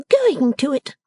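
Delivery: put-on high, petulant young lady's voice; Lavvy, sulky daughter. Daughter complains to her mother, high petulant whine. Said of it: "Miss Lavinia was constrained to close with".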